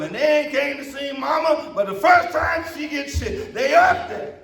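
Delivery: fired-up pulpit shout; preaching at full force. Acted. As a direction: preach it out to the whole church.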